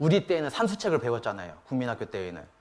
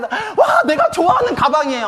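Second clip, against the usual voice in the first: very high, loud mock squeal of joy; delighted little child; 9 dB louder than usual. Kindergarten daughter gets a pink bag, imitated thrilled shriek. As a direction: gush like an overjoyed kindergartner. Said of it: "펄쩍펄쩍 돼요".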